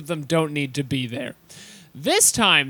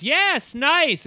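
put-on high baby Zeno voice; 9 semitones higher than usual.

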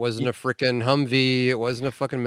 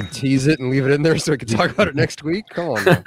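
higher, laughing mock incredulity; teasing disbelief; 5 semitones higher than usual. Real, not acted. Co-host ribbing host about teasing next week, amused disbelief. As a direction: echo it back as a ribbing.

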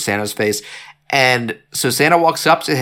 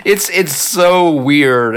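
high-pitched, talking through laughter; laughing; 7 semitones higher, much slower than usual. Real, not acted. Co-host amused by the odd killer line, laughing as he talks. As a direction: crack up, stretching out the words. Cracks up at the quote.

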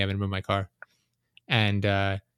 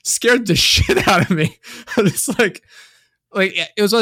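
laughing; 10 semitones higher, 6 dB louder than usual. Real, not acted. Retelling the fright, laughing.

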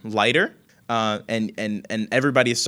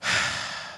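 breathy annoyed sigh; weary sigh; 4 dB quieter than usual. Acted. Young woman giving in to her grandmother, tired sigh. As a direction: sigh out in resignation. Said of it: "she says".

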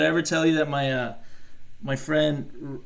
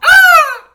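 loud high bird shriek; bird screech; 18 dB louder than usual. Pretend. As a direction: let out a shrill bird cry. Bird cry reply.

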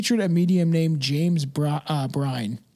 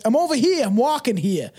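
put-on Brooklyn accent; 6 semitones higher than usual. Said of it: "That's what it makes you go into".

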